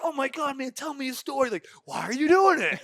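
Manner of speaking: high, loud eager questions; loud, high excited asking; excited questioning. Retold or imitated. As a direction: gush with excitement. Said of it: "I'm like".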